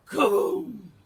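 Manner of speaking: very loud, explosive exclamation; storybook sound effect at full volume; crashing boom. A narrator's reading. Voicing the crash of the giant.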